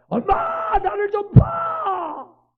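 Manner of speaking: put-on high, loud, very slow child's cry; a child crying out for mother; child calling mom.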